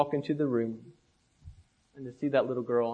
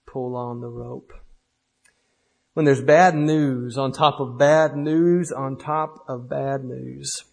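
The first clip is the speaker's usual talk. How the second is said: poem recitation; 5 dB louder than usual. Acted. Preacher reciting a poem to the church, loud then hushed refrain.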